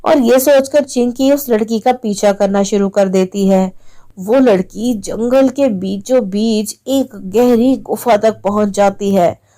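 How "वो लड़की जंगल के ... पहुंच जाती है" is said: usual speaking voice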